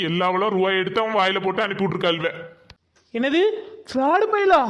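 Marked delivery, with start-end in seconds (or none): 3.1-4.6 high, loud cry of disbelief; shrieking high shocked question. Acted. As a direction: gasp it out in horror. Shocked the cable boy was fraud.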